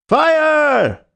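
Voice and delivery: very high, loud alarm shout; loud yell. A narrator's reading. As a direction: bellow it down the corridor. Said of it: "The shout must have rung over Norwood".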